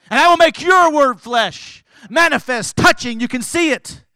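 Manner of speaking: loud, driving preacher cadence; full-force preaching. Acted. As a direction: preach it hard to the congregation.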